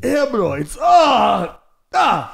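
hammed-up straining groans; mock straining. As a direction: push it out as if on the toilet. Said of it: "tryna squeeze a turd out".

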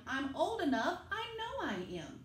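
put-on a small boy insisting he's old enough; little Sam insisting.

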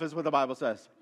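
ordinary talk

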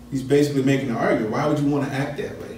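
normal talking voice

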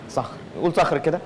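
صخر relished sound by sound; savoured pronunciation. Shown as a demonstration. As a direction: invite them to enjoy saying صخر. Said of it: "تستمتع بالكلمة".

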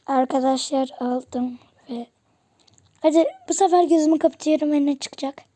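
normal talking voice

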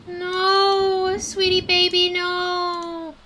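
high, long-held plaintive moan; mournful wail. Real, not acted. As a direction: plead sorrowfully with a lost pet. Mourning a game animal.

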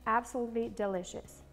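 ordinary delivery

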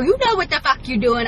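ordinary talk, nothing marked

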